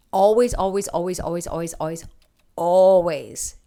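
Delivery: each "always" hit, the last one loud; emphatic repetition. Shown as a demonstration. Insisting to viewers on the key rule, repeated stress. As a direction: hammer "always" over and over. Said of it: "repeating always for emphasis".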